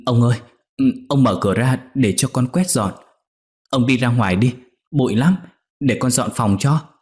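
put-on a startled girl begging him to leave; frightened outcry; startled cry. A narrator's reading. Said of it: "Con Nhị giật mình kêu lên".

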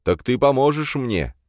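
high, hopeful begging question; wheedling plea. A narrator's reading. Friend pressing again for help, high and wheedling. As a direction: pester him with rising hope.

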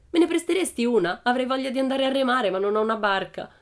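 high, loud taunting request; mocking. A narrator's reading. Bengt asking to borrow a shoe as boat, mocking. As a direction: needle her with a fake favour. Bully mocks Pippi's big shoes.

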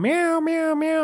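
a playful cat imitation; imitating cat meows. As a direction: mew like the pestering cat.

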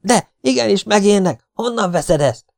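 high, loud, agitated contradiction; alarmed protest. A narrator's reading. Frightened partisan argues back about scorpion.

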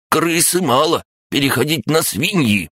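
very high broken-speech crab; squeaky little creature; snappy clicking crab. Acted. Crab gnome voiced.

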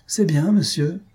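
high, mildly indignant answer; offended reply. A narrator's reading. Clockmaker answering scolding host, offended.

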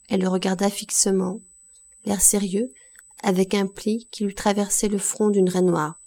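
ordinary delivery

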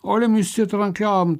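angry threat. Acted. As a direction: spit the death sentence at them. Executioner threatening prisoners.

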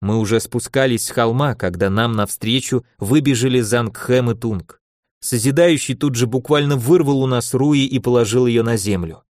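usual speaking voice

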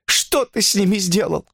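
tired but high-pitched woman's voice; worn-out, plaintive question; weary question. A narrator's reading. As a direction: ask it wearily, pitched high. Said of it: "устало спросила она".

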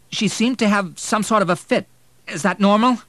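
high, loud anxious question; hesitant, worried. A narrator's reading. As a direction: voice a fear to the doctor. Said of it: "William hesitated".

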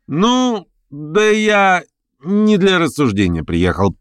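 careless slip. A narrator's reading. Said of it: "промахнулся значительным словцом Верховенский".

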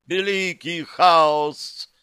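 a demoness crying out at her peak. A narrator's reading. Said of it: "вскрикнула демоница".

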